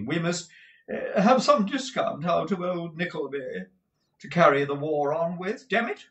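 high, loud, gushing dandy husband; a flamboyant husband fussing over money; Mantalini's voice. Acted. Mantalini asks his wife about the cash, high and gushing.

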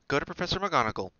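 high, loud, fast urging; urgent, insistent advice. A narrator's reading. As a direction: press them to go and tell. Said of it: "Hermione urged Neville".

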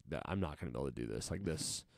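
fast, soft, giving-up mumble under his breath; quiet despairing mutter. Retold or imitated. To himself mid-test, sure he'll fail: a quick quiet mutter. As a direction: rattle it off quietly like you're losing hope. Panicking while treading water.

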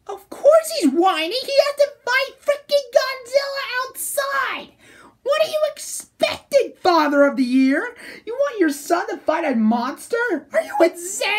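high, loud outraged rant; indignant yelling. Acted. Fan berating Shinji's father for sending him out, indignant yelling. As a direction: yell it in exasperated disbelief, voice way up.

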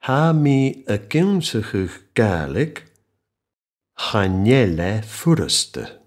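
very slow, measured model phrase; slowly. Shown as a demonstration. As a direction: draw out each word so learners hear the whole phrase. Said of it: "I'll say this slowly so that you can hear the whole phrase".

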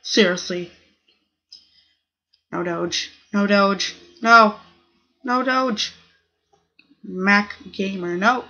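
ordinary delivery

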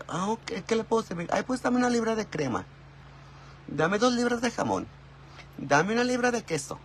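mock señora ordering cold cuts; señora at the deli. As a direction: play a chatty housewife at the counter.

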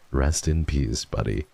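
ordinary talk

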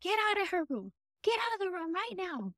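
grandma pretending to order the blue lady out of the room; mock commanding grandma. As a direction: play grandma shooing away a ghost she can't see. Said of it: "pretends like she's telling the lady".

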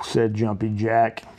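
plain reading voice

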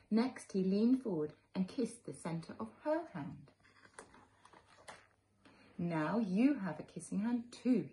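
put-on gentle read-aloud for little children; storybook narration. A narrator's reading. Teacher reading a picture book to children, gently. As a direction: tell it like a bedtime story to kids.

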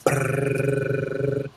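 rolled lips drum roll noise. Acted. Mouth drum roll for the envelope reveal, rolled trill. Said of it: "Drum roll, please".

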